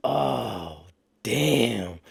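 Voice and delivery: low, soft, drawn-out dismay; retold dismay. Seeing the tower cop right above, retold dismay. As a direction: let it sink out slowly, deep and hushed.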